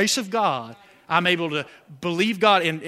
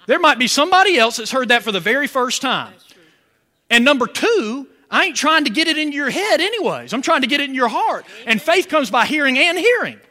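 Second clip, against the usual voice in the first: louder driving sermon voice; preaching at full force; 5 semitones higher, 5 dB louder than usual. Acted. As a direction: press the point home to the congregation. Preacher builds to emphasis.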